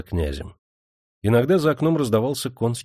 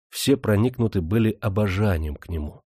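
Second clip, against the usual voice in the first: usual speaking voice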